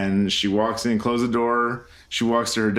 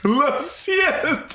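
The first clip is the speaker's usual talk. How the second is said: high, drawn-out words through laughing; helpless laughter; slower than usual. Real, not acted. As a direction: repeat the joke while cracking up. Cracking up at co-host's joke.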